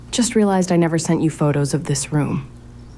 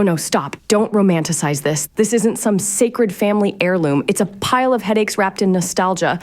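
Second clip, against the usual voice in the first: ordinary talk, nothing marked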